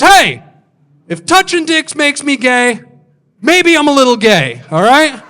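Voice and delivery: high, loud, indignant comeback; defensive shout. Retold or imitated. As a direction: yell it back, hammed-up defensive. Guy defensively shouting back.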